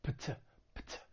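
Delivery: pt as two quick voiceless consonants; voiceless p-t cluster. Shown as a demonstration. Teacher demonstrating the past-tense ending, voiceless pt. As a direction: release pt softly, p straight into t. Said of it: "It's gonna sound like pt".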